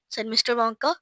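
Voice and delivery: ordinary delivery, nothing marked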